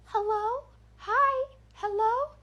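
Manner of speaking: put-on very high, eerie little-girl greetings; haunted toy calling out in a piercingly high pitch; creepy doll voice. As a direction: imitate a horror doll calling, each word separate. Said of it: "just like that, creepy doll voice".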